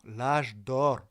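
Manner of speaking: put-on l'âge d'or with heavy Russian accent, loud. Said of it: "obligé de parler avec l'accent russe".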